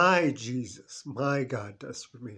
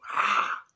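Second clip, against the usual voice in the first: gasp; 3 dB louder than usual. Shown as a demonstration. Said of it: "Say it".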